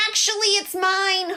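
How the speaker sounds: goose claiming. Acted. Goose voiced, rival claim.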